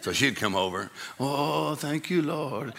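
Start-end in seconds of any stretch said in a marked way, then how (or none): 1.2-2.7 a touch of swooning praise; lightly voiced grateful church lady; rich woman praising. Imitating rich Baptist woman praising, mild swoon. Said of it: "she'd start praising the Lord".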